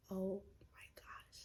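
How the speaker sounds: breathless whisper of dread; unvoiced panicked hush; scared whisper. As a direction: whisper it like you just got caught. Hearing mom grab the belt.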